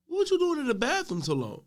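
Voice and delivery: high-pitched retold question to a child; mock questioning. Custodian asking the boy back from the bathroom, high-pitched questioning.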